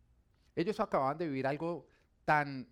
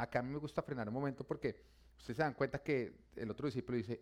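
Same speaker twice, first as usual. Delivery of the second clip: ordinary delivery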